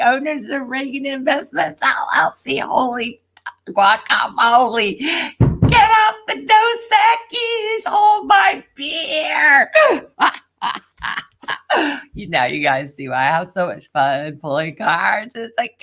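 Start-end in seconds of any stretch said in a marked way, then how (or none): 5.7-10.3 high, loud and slow celebration; triumphant party call; gleeful. Real, not acted. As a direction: savor every word of the win. Celebrating the reading.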